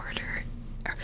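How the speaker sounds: ordinary talk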